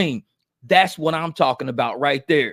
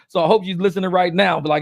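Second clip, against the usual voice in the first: usual speaking voice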